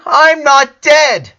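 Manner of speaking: slow, high, loud sobbing shout; crying shout. A narrator's reading. Lincoln shouting at his crying sisters, sobbing and loud. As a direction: shout it out through tears. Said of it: "He shouted so loud".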